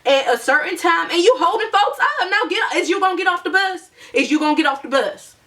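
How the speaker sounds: high, mocking impression of a rude driver; hammed-up scolding bus conductor; exaggerated high voice of an annoyed driver. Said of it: "And he was like".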